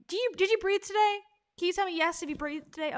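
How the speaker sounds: mock motivational speaker. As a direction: parody a speaker fishing for a yes. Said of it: "she's gonna ask things that".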